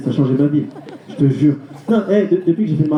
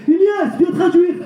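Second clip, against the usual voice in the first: crier le prénom d'un coup, beaucoup plus haut; héler son fils depuis la piscine; appel du père crié; 12 semitones higher, much slower than usual. Retold or imitated. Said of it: "il dit". (le père appelle son fils en criant)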